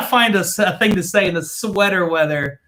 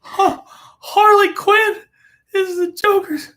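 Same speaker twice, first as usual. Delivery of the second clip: high, slow, dim-witted drawl; mocking imitation; 12 semitones higher, 4 dB louder, slower than usual. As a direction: play dumb and stretch every word. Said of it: "She's like".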